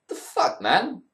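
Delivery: under-breath disbelieving mutter; breathy, muttered disbelief; incredulous mutter. Real, not acted. Outraged at Steph's promo claim.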